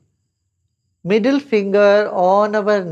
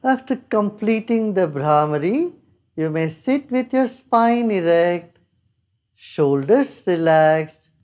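guided meditation. Acted. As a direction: ease them gently into calm.

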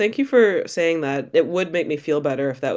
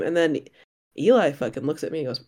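ordinary delivery

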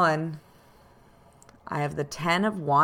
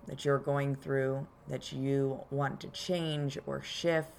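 calm grounding exercise; 4 dB quieter than usual. Acted. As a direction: guide them gently into their body. Leads a grounding meditation.

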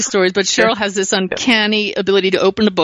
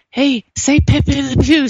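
slow, higher, coaxing "Pepe Le Pew" from a grandfather in spirit; lightly voiced grandfather; 6 semitones higher, slower than usual. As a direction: gently urge a little girl to say "Pepe Le Pew". Grandfather voiced telling child.

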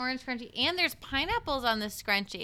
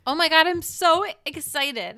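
excited; 7 semitones higher, 8 dB louder than usual. Real, not acted. Real excitement about live shows.

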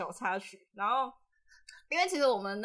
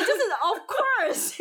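drawn-out, high mock-smug "of course"; playful imitation of a proud reply; 9 semitones higher, much slower than usual. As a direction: say "of course" like it's obvious, mocking. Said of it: "的那种感觉".

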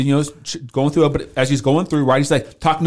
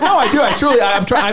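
mock protest; 7 semitones higher than usual. Husband protesting to wife.

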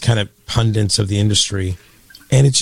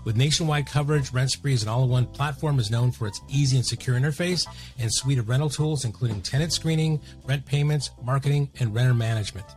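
soft, even sponsor read; 10 dB quieter than usual. Acted. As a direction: read the sponsor spot smoothly. Sponsor ad read over music.

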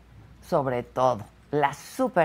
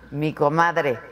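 ordinary delivery

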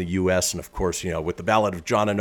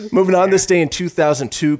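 loud, higher show-host segue; announcer read; 8 semitones higher, 7 dB louder than usual. Acted. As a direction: announce the next item big. Segment announcement.

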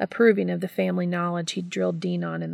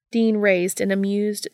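plain reading voice

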